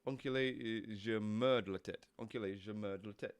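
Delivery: low, soft "Enculé, je merde la tête", sounded out in French; French pronunciation practice. Shown as a demonstration. Streamer practising French swear words, low careful "Enculé, je merde la tête". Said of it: "merde is shit".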